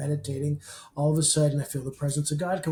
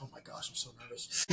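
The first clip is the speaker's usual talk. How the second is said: whispered, quick, much softer confession; nervous whisper; 6 semitones lower, 15 dB quieter, faster than usual. Retold or imitated. Admitting nerves to the kids.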